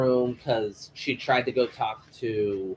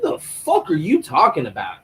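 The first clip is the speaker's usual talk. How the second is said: raised, louder baffled demand; mock incredulous; 6 semitones higher, 5 dB louder than usual. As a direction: demand it in exaggerated disbelief. Acted baffled reply to nonsense.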